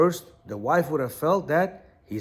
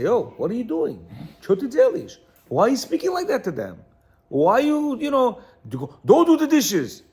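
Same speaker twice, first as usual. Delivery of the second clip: raised, higher voice, scolding the wife; mock angry scolding; 5 semitones higher, 4 dB louder than usual. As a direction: play the husband telling her off, building louder. Husband scolding wife, acted.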